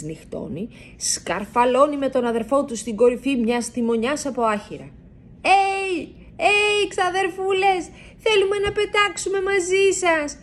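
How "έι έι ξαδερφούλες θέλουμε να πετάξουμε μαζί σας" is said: high, drawn-out call up to the bats; a mouse calling from the haystack top. A narrator's reading. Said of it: "φωνάζει στις νυχτερίδες".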